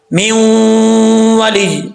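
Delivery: "میوں ولی" with long hummed oo, short ending; doubling dropped. Shown as a demonstration. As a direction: let "ولی" end lightly without holding the y. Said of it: "شد کو چھوڑ دیتے ہیں". ("ولی" شد چھوڑ کر)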